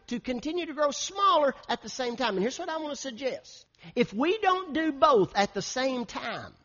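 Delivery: high-pitched, emphatic pulpit delivery; urgent preaching. Real, not acted. Pastor urging the church to grow larger and smaller, preaching emphatically. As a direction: press the vision on the congregation.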